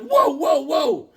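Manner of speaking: high-pitched pleading to slow down; mock alarmed protest. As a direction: fend off an angry wife, hammed-up. Protesting to furious wife.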